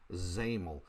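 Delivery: "xaml" starting with a Z, not spelled out; pronunciation of xaml. Shown as a demonstration. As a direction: say "xaml" as one word, ZAM-ul. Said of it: "X A M L is pronounced".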